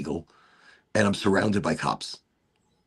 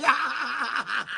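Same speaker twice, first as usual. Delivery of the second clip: high, laughing exclamation; real laughter. Real, not acted. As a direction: burst out laughing. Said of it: "I'm laughing".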